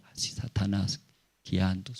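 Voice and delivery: normal talking voice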